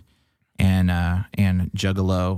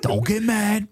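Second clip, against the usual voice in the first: higher-pitched imitated Michigan accent; 13 semitones higher, slower than usual. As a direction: warn him in a put-on Michigan accent. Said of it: "a very classic Michigan accent".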